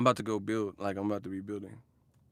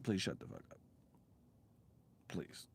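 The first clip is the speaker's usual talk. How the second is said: very soft, low murmur; girl's weary plea; 5 semitones lower, 16 dB quieter than usual. Retold or imitated. Imitating the girl begging him to stop, very quiet. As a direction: mutter it under your breath, fed up. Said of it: "she's like".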